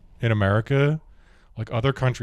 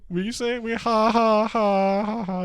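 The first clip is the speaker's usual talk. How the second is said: Borat impression; 11 semitones higher than usual. Woman quoting Borat to a counselor, high accent and mock laughter. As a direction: do Borat cackling ha ha. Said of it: "he's like".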